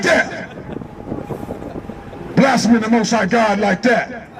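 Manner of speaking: preacher's rising denunciation; fiery condemnation. Acted. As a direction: pronounce judgment on him. Condemning Cain's blasphemy.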